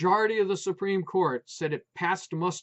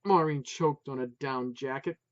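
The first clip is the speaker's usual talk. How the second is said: ordinary delivery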